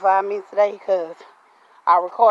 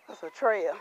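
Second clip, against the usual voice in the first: ordinary delivery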